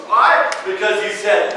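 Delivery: usual speaking voice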